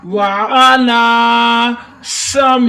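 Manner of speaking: وعلا سمعہم very slow, held laa and sharp whistling s; stretched, level وعلا سمعہم; whistled seen. Shown as a demonstration. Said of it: "سین کو سیٹی کی آواز دیتے ہوئے".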